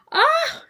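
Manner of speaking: high, loud short shriek; sudden yelp. Pretend. Streamer hides her donation box from viewers, yelping high. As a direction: yelp as if caught out.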